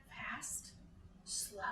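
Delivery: breathless hush before the song; whispered teaser. Acted. Librarian whispering the next verse to kids, whispered. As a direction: whisper it like a secret.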